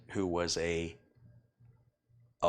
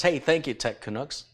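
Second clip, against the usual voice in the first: excited thanks; 6 semitones higher, 4 dB louder than usual. Real, not acted. Thanking donor on stream.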